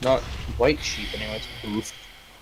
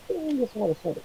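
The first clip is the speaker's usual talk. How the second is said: muffled, as if underwater; 11 semitones higher, much faster than usual. Real, not acted. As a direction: voice coming through water. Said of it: "It sounds like you're underwater".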